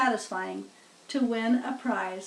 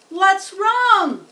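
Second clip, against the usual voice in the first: higher, louder announcer question; loudspeaker asking; 8 semitones higher, 8 dB louder than usual. A narrator's reading. Loudspeaker asks crowd about fainted pig, alarmed announcer. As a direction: call out a hint of alarm. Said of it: "asked the loudspeaker".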